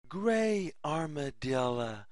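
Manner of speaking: usual speaking voice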